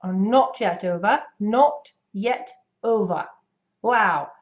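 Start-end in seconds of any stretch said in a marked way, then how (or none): 0.0-3.2 British imitation with crisp T's; rounded, closed British vowels, crisp T's; British accent. Shown as a demonstration. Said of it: "for the British accent".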